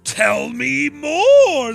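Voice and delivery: loud, high, very slow and drawn out; egging them on, hamming it up; mock eager. Prodding the anime kids.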